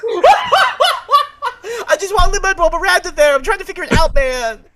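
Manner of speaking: high, loud laughing plea; laughing, pleading. Real, not acted. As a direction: giggle out the excuse. Laughing plea after teasing.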